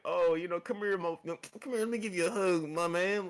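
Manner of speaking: lightly voiced old man; imitated old man. Old man asking for a hug, lightly imitated. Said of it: "the old man was just like".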